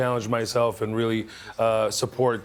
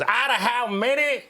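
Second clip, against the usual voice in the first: heckler; 11 semitones higher than usual. Retold or imitated. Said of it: "a guy in the crowd goes".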